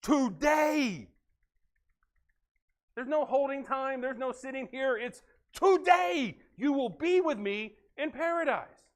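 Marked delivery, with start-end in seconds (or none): 0.0-1.1 a ringing, loud "Today"; proclaimed answer. Retold or imitated. 5.5-8.8 slow and high, leaning on "be"; slow proclamation. Retold or imitated. Jesus' promise, drawn out.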